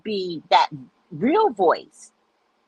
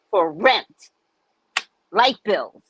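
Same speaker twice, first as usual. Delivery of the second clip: ordinary talk, nothing marked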